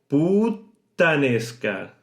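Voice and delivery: "puttanesca" said slowly with a clear pause on the double T; held double T. Shown as a demonstration. Teacher showing how natives say it, slow with a clear pause. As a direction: stop on the T of "puttanesca", then release it. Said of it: "¿Habéis oído la pausa?".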